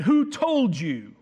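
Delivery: "Who" hit hard and slow, falling; stern emphasis on who. Shown as a demonstration. God confronting Adam in the garden, stern stressed "Who". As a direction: bear down on "Who told you". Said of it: "I put some emphasis there".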